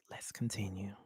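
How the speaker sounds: unvoiced whispered aside. Real, not acted. Co-host whispering to move the show on. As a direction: breathe it, barely audible.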